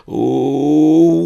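long, loud, high spectral "ooh"; swelling wail of a ghost; ghostly moan. A narrator's reading. Spectre drifting through the mist at grave robbers, eerie moan. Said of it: "The horrible spectre moaned".

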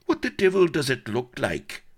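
high, loud sarcastic retort; irate, scornful comeback. A narrator's reading. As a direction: throw it back with exasperated sarcasm. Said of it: "was the sarcastic reply".

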